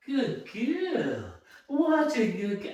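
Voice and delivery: high, hushed cooing; tender sing-song praise; soft dog praise. Real, not acted.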